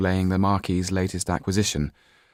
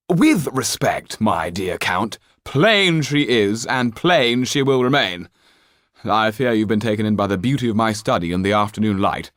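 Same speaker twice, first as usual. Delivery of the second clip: higher and louder, a cold aristocratic father; haughty Marquis; 4 semitones higher, 5 dB louder than usual. Acted. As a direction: look down your nose at your daughter. Marquis rebuts Count.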